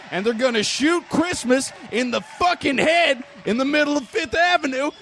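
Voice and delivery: building to a shout. Acted. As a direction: rant rising to a yell.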